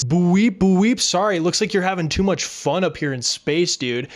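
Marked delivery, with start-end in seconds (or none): 0.0-1.0 mock police siren boo-weep, rising; siren imitation. Pulling Mando over, a mock police siren. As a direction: whoop it like a cop siren.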